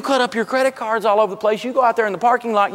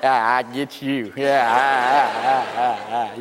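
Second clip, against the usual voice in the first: lower, sarcastic fake laugh back at him; mock laugh back; 8 semitones lower, slower than usual. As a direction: laugh right back with a low voice. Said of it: "I'm going".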